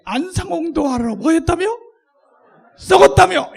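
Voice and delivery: building to a loud, high sneer on "썩었다며"; loud mocking retort. Shown as a demonstration. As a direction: shout the jab at them. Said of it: "이렇게 하는 거예요". (점점 크게 비꼬며 외침)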